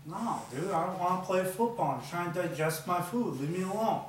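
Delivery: put-on belligerent drunk. Stuffed snake refuses football.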